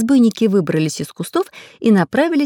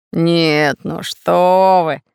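slow, drawn-out fake hurt; feigned offence; 3 dB louder, much slower than usual. A narrator's reading. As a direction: protest slowly, playing the wronged innocent. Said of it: "притворно обиделся Бульбуль".

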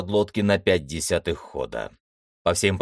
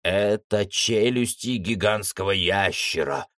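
usual speaking voice

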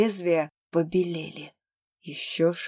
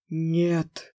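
strained; 2 semitones lower than usual. Acted. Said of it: "с усилием выдавил из себя Тейн".

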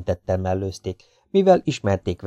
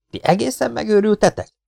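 high-pitched scolding, held back in volume; lehalkított ripakodás; 13 semitones higher than usual. A narrator's reading. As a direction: rebuke them without really shouting. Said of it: "Ripakodott rájuk a lehetőségekhez képest lehalkítva". (magas, visszafogott hangú ripakodás)

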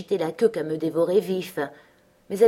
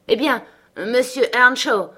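louder, higher scolding outburst; indignant exclamation; 5 semitones higher, 5 dB louder than usual. A narrator's reading. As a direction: turn your vocal artillery on the young man. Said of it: "s'écria-t-elle".

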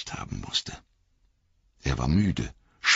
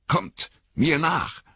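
high, slow call over the shoulder; 11 semitones higher, slower than usual. Acted. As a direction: call back to the others. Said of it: "rief er über die Schulter".

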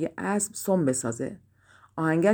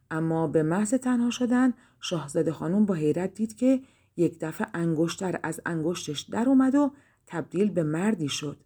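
usual speaking voice

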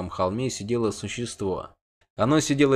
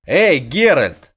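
loud drawn-out call across the camp; slow loud hail; shout; 4 semitones higher, 7 dB louder, much slower than usual. A narrator's reading. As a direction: call out slowly to Geralt. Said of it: "крикнул Богольд".

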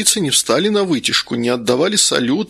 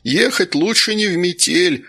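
shouting over wind; 6 semitones higher than usual. A narrator's reading. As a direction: yell to be heard over a storm. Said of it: "прокричал, перекрикивая усиливающийся ветер".

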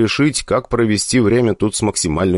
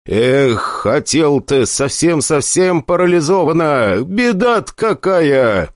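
mock pity; 7 semitones higher, slower than usual.

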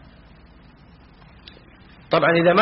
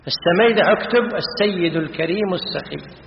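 usual speaking voice

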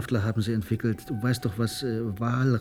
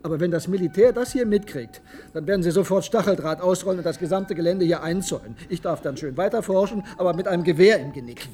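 heated rant; 6 semitones higher than usual. Acted. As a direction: paint the threat bitterly. Hitziger Streit. (aufgebracht, hoch und laut)

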